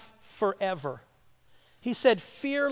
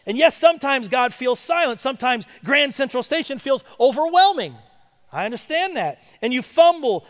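higher, louder preaching that swells into a shout; full-force preaching; 3 semitones higher, 6 dB louder than usual. Acted. Pastor preaches to the congregation, rising to a shout.

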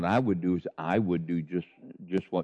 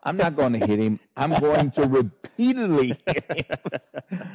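loud, raised, slowing on the punchline; comic mock threat; 6 semitones higher, 5 dB louder than usual. Co-host jokes about hitting the boy, loud mock declaration. Joking acted reply to the diners.

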